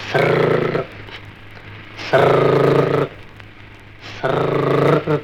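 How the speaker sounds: long hissing "sarrr", repeated with pauses; imitated rustle of leaves. Son mimicking steps on dry leaves, eerie rustle. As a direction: crunch dry leaves with a drawn-out trill. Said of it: "அது மேல காலை வச்ச உடனே".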